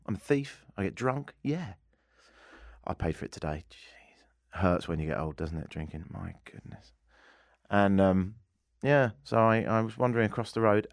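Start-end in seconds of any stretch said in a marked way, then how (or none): 2.8-6.1 hushed, whispered confession; rueful aside. Real, not acted. Radio host confessing the morning after, rueful whisper. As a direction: drop to a pained whisper.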